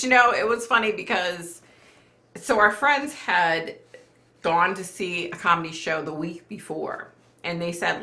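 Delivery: ordinary talk, nothing marked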